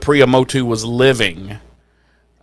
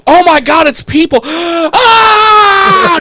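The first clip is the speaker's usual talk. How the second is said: high, loud panicked cry with a gasp; mock terror; 6 dB louder, much slower than usual. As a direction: overact the scared guy. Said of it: "he just sees".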